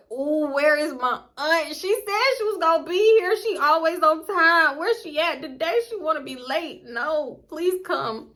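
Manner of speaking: high, drawn-out mock desperation; anxious pleading. Guest silently begging late aunt to come, desperate. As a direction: plead for someone to come rescue you. Desperate wait for aunt's rescue.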